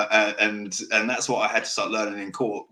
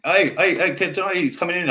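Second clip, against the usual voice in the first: loud, high greeting call; 8 semitones higher, 5 dB louder than usual. Real, not acted. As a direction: hail the guest cheerfully across the room. Calling out to a new guest.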